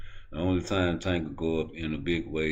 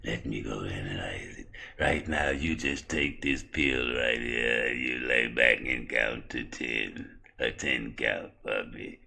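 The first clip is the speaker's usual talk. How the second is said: very slow, low, soft creepy voice; sinister drawn-out doctor drone; Victor; 9 semitones lower, 5 dB quieter, slower than usual. Acted. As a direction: stretch every word out eerily. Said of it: "why do you talk like that".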